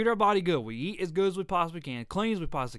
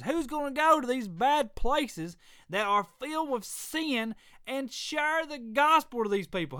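raised, higher, fervent preaching voice; 7 semitones higher than usual. Acted. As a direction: preach it out, full fervour. Preaching to podcast listeners.

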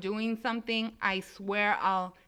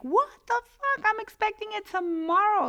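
high, loud disbelieving outburst; shocked outrage; 12 semitones higher, 4 dB louder than usual. Retold or imitated. Retelling the appliance call, shocked and outraged. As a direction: squeal it out in stunned disbelief. Retold shock at delivery date.

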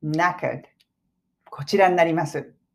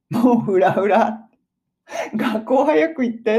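worn-out parent; 5 semitones higher, slower than usual. Acted. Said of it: "思ってるんじゃないかな".

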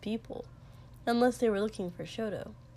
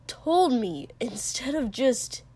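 higher, louder sharp retort; 3 semitones higher, 4 dB louder, slower than usual. A narrator's reading. Katsuki about the one who left, sharp and louder. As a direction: cut in hard. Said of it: "Katsuki said sharply".

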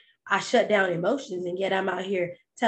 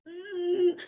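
high, held-in whimper; mock whimper; 9 semitones higher than usual. Child with a scraped knee, holding in crying. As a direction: imitate a child fighting back tears.

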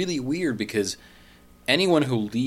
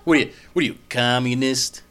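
mock Southern sneer; 3 semitones higher, 6 dB louder than usual.

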